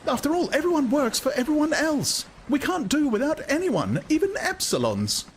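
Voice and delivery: high, shocked woman's protest; a woman defends the social body; Lenina's voice. A narrator's reading. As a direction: argue the creed with dismay. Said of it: "Lenina arguing".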